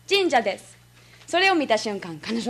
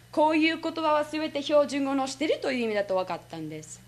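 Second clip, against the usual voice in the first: usual speaking voice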